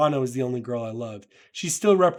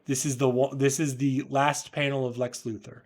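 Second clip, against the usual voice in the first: ordinary delivery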